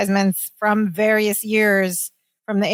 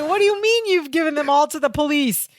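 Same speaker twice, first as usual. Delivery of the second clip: higher-pitched indignant Larry voice, laughing through it; 8 semitones higher than usual. Retold or imitated. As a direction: play Larry outraged at the phone company, voice pitched up. Host acts out Larry's phone complaint.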